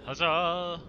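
high, loud triumphant cheer; roaring tyrant king. Pretend. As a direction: bellow it like a victorious king. Game character cheers as king.

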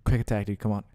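ordinary talk

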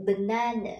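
banana with short "ơ" in first and last syllables; short schwa. Shown as a demonstration. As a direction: say banana English-style, stressed long middle, weak "ơ" ends. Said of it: "A sẽ phiên âm ra là âm ơ ngắn". (banana chuẩn Anh, âm "ơ" ngắn)

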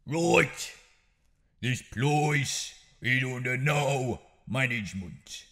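strong Brummy. Shown as a demonstration. Gangster laying down the law in a strong Brummy accent. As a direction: punch the words in a heavy Birmingham accent. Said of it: "hit certain words with a very strong Birmingham accent".

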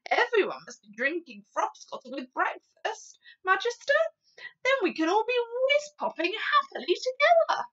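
loud, high and jubilant giant; eager giant. A narrator's reading. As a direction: enthuse loudly to the Queen. Giant enthuses to the Queen.

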